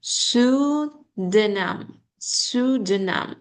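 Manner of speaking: pseudonym said slowly with silent P. Shown as a demonstration. Teacher models pseudonym for the student, slowly. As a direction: sound out pseudonym, no P. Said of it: "P is silent".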